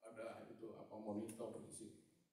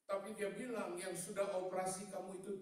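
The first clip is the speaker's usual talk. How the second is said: loud, high pulpit voice; full-force preaching; 8 semitones higher, 9 dB louder than usual. Real, not acted. Preacher wondering aloud about the doctor's religion, full-force preaching. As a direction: call it out high over the congregation. Preaching on after congregation responds.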